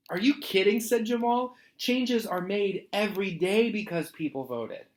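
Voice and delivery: incredulous. Acted. Jamal answering the sad lady, incredulous high kid voice. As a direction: react like he can't believe it.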